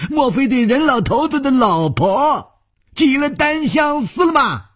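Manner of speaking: very high, put-on cackling voice; gleeful mocking villain. A narrator's reading. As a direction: jeer gleefully at the top of the pitch range. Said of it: "白玉魔笑着说".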